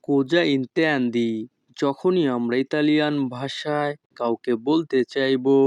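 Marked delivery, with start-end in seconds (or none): 0.1-1.3 Cosa intendi said slowly, word by word; slow demo. Shown as a demonstration. Teacher asks the question slowly for students to copy. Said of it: "Italian phrase demo".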